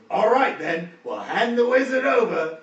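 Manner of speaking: loud, high, slow orc bargaining; orc Guz. Acted. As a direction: make the deal like a sly orc. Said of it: "He says".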